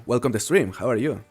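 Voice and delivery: normal talking voice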